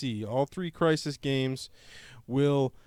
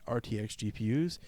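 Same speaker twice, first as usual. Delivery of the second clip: usual speaking voice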